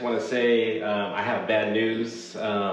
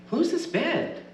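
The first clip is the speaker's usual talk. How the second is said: character voice; slower than usual. Acted. Character voice in story.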